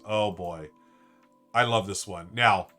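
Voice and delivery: ordinary delivery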